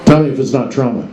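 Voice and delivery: ordinary talk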